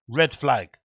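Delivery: usual speaking voice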